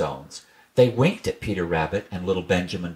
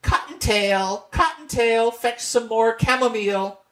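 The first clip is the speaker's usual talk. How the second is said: mother rabbit calling; 14 semitones higher than usual. Acted. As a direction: call the child home from down the hole.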